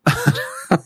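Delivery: real, very high squeaky giggle. Real, not acted. Guest cracking up over the silly game sound, squeaky giggle. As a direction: burst into a high-pitched giggle. Laughing at the pig noise.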